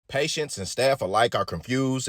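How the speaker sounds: ordinary talk, nothing marked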